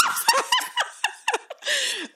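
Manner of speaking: a high, helpless burst of laughter. Real, not acted. Retelling a bad date to her co-host, bursting into a high laugh. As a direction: crack up laughing.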